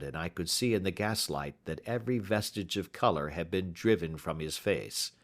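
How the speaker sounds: ordinary delivery, nothing marked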